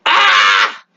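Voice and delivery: drawn-out exasperated "ah"; slow groaning yell of frustration; frustrated cry. Real, not acted. Viewer fed up with the episode, exasperated cry.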